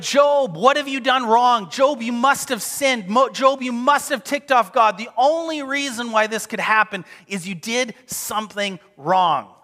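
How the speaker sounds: loud, high-pitched mock accusation; insistent retold blaming, starting high and easing down; accusing. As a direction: press the charge hard, as Job's friends. Friends accuse Job in acted quote.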